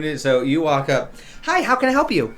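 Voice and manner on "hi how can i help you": high, loud customer-service greeting; gnome barista. Acted. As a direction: chirp it like an eager little barista.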